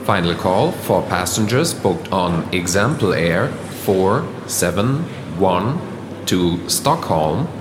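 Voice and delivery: formal English gate call; airport announcement. Acted. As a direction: read it like a terminal announcer.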